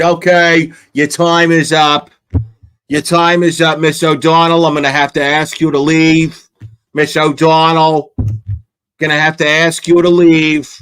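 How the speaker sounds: mock official cutting a speaker off. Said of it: "this asshole's gonna minimize it by going".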